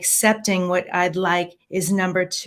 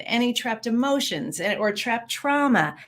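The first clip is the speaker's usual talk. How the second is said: ordinary talk, nothing marked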